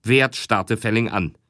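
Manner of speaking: ordinary delivery, nothing marked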